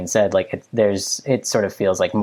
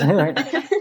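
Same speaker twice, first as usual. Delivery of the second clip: high giggle; 10 semitones higher than usual. Real, not acted. Laughs at own joke.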